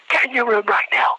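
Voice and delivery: high, yelled military order; drill-instructor scream. Retold or imitated. Gunnery sergeant sending the candidate into his room, mock screaming. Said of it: "I just hear him screaming".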